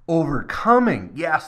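loud and high on one word; preaching swell. Real, not acted. Pastor proclaiming the Father's love, loud and rising. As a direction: lift the voice in triumph.

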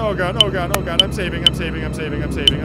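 loud, rushed nervous babble; nervous panic. Real, not acted. Player dreading the roof and saving, nervous panic. Nervous rush to save the game.

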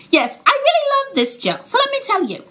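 high, put-on British accent; playful mock-posh British voice. Vlogger hamming a British accent for viewers. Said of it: "enough British accent for today".

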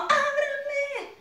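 "¡ábranme!" high and drawn out slowly; urgent plea. Shown as a demonstration. Teacher shows the feeling of the move, a high drawn-out cry. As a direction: cry "¡ábranme!" like banging on a door. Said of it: "Esa, esa sensación".

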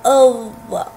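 isolated emphatic interdental ẓ in "أض-"; letter sound demo. Shown as a demonstration. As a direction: demonstrate "أض-", tongue tip between the teeth. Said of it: "هكذا ينطق حرف الضاد".

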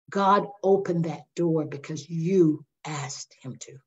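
slow, whispered, fading to nothing; hushed revelation. Retold or imitated. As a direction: breathe it like a sacred secret, slowly. Pastor relays God's gentle rebuke.

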